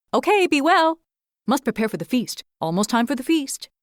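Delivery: quick, determined squirrel. A narrator's reading. Busy squirrel fires questions at the curled hedgehog, brisk and jumpy.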